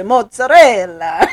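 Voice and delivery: put-on slow, drawn-out Italian accent Mozzarella, rising and laughing. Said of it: "I love the Italian accent".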